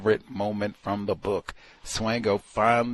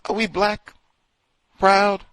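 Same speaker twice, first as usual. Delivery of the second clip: high, loud rallying call; imitating Jim Jones; 9 semitones higher, 7 dB louder than usual. Jim Jones rallying followers, loud preacher call. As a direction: shout it like a cult preacher. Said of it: "when he says".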